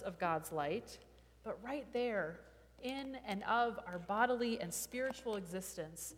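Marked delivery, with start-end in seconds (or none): none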